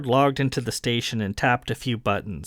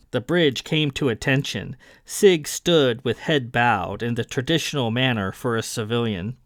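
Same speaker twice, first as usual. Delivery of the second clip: ordinary delivery, nothing marked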